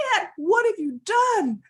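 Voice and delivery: loud, high anguished cry; upset dog. Acted. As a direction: wail the accusation at full voice. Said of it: "Dog was so upset".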